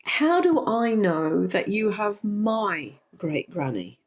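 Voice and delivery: ordinary delivery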